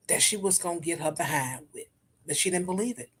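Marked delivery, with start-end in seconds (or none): none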